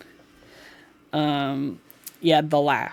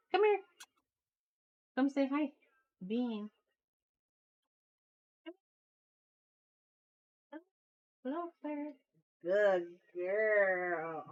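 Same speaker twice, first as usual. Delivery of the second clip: soft pet coaxing; 5 semitones higher, 11 dB quieter than usual. Real, not acted. Streamer hushing her dog to say hi, high soft baby talk. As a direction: murmur sweetly to the pet.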